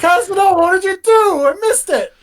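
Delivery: very high, loud wail; mock-outraged shout. Harpooner blaming Cosmo for the missed shot, mock-outraged shout. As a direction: cry out in high-pitched dismay. Harpooner blames the caster in character.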